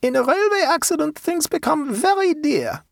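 put-on high German-accented haggler; German peasant woman. A narrator's reading.

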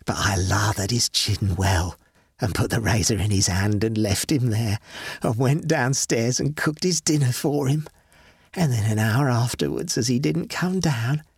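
a cold, satisfied murderess; dead wife, relishing. Acted. Dead wife gloating, lower.

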